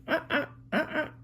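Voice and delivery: high monkey squeals in short bursts; monkey chatter. Acted. Parent voicing the monkey on the phone, monkey chatter. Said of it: "And he's saying".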